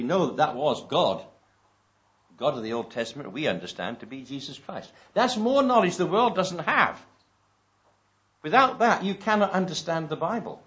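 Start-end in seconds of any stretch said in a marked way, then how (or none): 5.1-10.6 forceful preaching. Real, not acted. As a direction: hammer it home to the congregation. Preacher driving home the point.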